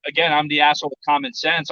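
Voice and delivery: ordinary delivery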